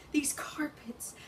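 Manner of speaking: hushed slow whisper. Acted. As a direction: drop to a breathy whisper. Hushed line inside monologue.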